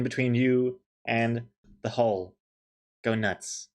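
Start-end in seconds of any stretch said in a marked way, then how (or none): none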